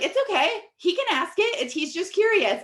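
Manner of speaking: imitating an easygoing guest, pitched well up; high-pitched reassurance. Dinner guest excusing a curious kid, high-pitched mock reassurance.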